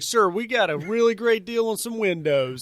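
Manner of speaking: high, loud pushy pitch; eager salesman. Pretend. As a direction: sell him hard, loud and high. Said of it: "He's like".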